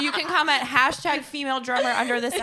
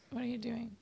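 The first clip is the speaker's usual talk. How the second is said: whispered aside; quick hushed question; 12 dB quieter than usual. Real, not acted. Aside to cohost during outro.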